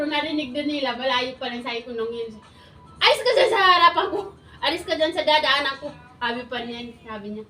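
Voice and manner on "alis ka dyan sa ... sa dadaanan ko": loud, high retold shout; angry order. Wife shouting at someone blocking her path, loud. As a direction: bark the order to clear the way.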